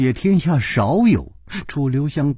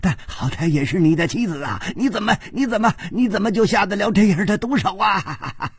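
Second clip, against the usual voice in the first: higher, fast broken accusing cries; shrieking grief and rage; 6 semitones higher than usual. Acted. The king accuses his son-in-law of murdering his daughter, shrieking. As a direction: rail at him through choked fury. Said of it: "龟兹王嘶叫着说".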